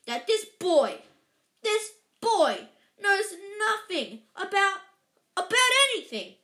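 loud, high outrage, building; furious growl. A narrator's reading. Giant raging at the Dursleys about the boy, furious. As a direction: hammer out each phrase, rising to a shout. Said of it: "he growled at the Dursleys".

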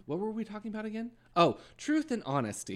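high, quick faux-innocent aside; mock innocent. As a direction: lightly play the feigned forgetfulness. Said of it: "she was like".

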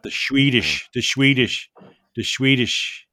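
"Swedish" said as "Shweedish", punched loud; relished pronunciation. Shown as a demonstration. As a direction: savor a slushy SH at the start of "Swedish". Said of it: "I like to say that".

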